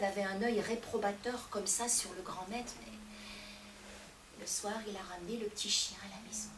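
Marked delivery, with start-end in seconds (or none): none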